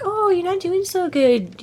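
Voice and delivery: high, drawn-out creepy cooing; creepy mock sympathy. Creepy fairy toying with hurt wizard, mock-sympathetic. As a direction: imitate a creepy fairy feigning concern. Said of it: "she's just all creepy".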